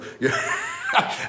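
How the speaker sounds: short unvoiced laugh; breathy laugh. Real, not acted. Laughs at own story.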